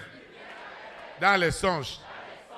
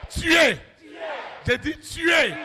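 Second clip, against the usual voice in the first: higher, shouted repeat with weight on tuer; commanding shout; 8 semitones higher, much slower than usual. Acted. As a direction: hammer tuer home.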